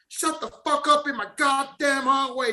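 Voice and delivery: high, drawn-out mock yell; imitated guard yelling. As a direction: holler slowly in a high voice, imitating an angry guard. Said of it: "this motherfucker yelling".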